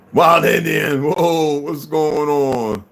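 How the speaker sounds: loud, slow, stretched-out shout; shouted greeting. Real, not acted. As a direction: call out the hello long and loud.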